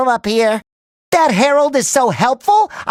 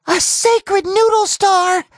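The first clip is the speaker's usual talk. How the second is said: reverent awe; 8 semitones higher, slower than usual. Acted.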